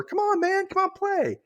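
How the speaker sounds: very high, eager mock invite; high, chirpy pretend enthusiasm; happy invitation. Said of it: "happily invites Craig over".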